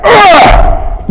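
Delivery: hammed-up heave; exertion grunt. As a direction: strain out an exaggerated weightlifter's "ugh". Imitating Macho Man heaving a weight.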